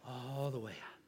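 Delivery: preacher softly guides exhale; soft breath-out cue. Shown as a demonstration. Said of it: "learn to relax".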